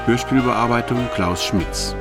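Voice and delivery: ordinary delivery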